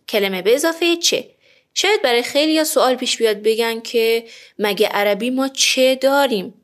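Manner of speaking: ordinary talk, nothing marked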